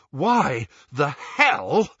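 out of breath, loud; panting. Acted. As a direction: pant it out hard. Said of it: "panted Ron".

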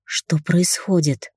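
slow, rasping, frightened whisper-croak; hoarse croak. A narrator's reading. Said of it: "прохрипела я".

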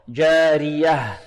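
جارية recited slowly with a light, thin ra; slow chanted جارية, unemphatic r. Shown as a demonstration. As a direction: draw out جارية, keeping the r light. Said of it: "فهي مرقة".

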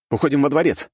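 high urgent proposal; crown prince urging. A narrator's reading. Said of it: "предложил цесаревич".

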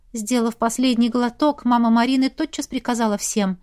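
ordinary delivery, nothing marked

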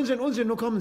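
sudden hearty protest. Acted.

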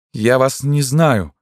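high, slow cry of denial. A narrator's reading. Tormented woman disowns the man, crying out. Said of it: "крикнула она".

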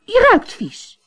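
put-on high, loud, revolted girl; disgusted princess. Princess scolds the frog at dinner, high and disgusted. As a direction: spit it out in disgust.

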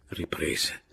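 usual speaking voice

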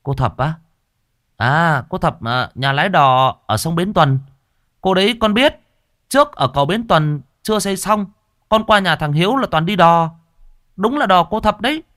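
a lightly voiced young helper recalling; young man.

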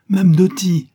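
high, loud, delighted greeting; joyful cry. A narrator's reading. Young man greets the good lady, joyful cry. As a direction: cry out her name happily. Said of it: "cria-t-il, toujours joyeux".

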